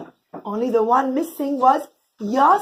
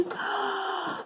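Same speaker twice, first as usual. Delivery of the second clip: soft gasp; 7 semitones higher, 11 dB quieter than usual. Acted. Yosef moved by brothers.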